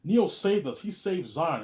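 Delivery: high, loud insistence; acted protest. Crewmate defending Neo to the hero, acted loud protest.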